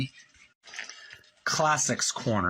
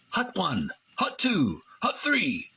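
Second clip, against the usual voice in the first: rising quarterback snap count, playful; quarterback call; 7 semitones higher, slower than usual. Pretend. Vlogger playing quarterback calling the snap count.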